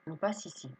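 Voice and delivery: ordinary talk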